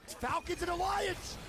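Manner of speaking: high, slow hype callout; excited call. Real, not acted. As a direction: stretch it out, hyped. Caster calls a big fight.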